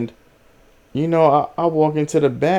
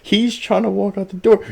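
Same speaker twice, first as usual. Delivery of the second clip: usual speaking voice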